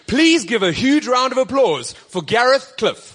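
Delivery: announcer shout. Real, not acted. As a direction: call him out to the crowd. MC introduces guest to crowd.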